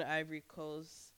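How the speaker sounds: ordinary talk, nothing marked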